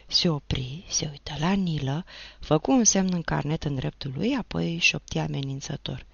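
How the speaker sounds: usual speaking voice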